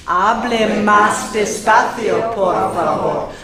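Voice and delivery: very slow, stretched teacher's model; laborious. Shown as a demonstration. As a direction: labour over each word for learners to repeat. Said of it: "you have to say laboriously".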